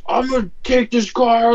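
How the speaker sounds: high mocking imitation of a driver; pushy, high put-on voice; in-your-face tow driver. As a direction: mimic the tow guy getting in your face. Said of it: "He, like, gets in my face".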